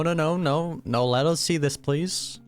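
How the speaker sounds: normal talking voice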